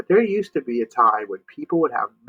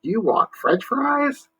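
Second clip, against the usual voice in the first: put-on high, slow, confused old-man voice; a sleepy old man mishearing; deaf old man; 13 semitones higher, slower than usual. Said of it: "the old man spoke".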